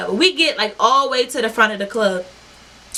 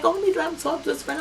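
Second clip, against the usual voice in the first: mock breezy; 7 semitones higher, 6 dB quieter, faster than usual. Acting unbothered about the girl.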